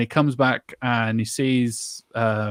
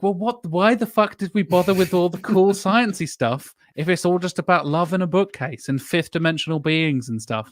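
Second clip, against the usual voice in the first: exasperated rant; 6 semitones higher than usual. Retold or imitated. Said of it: "I'm like".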